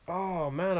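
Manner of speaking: normal talking voice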